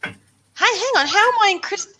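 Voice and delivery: usual speaking voice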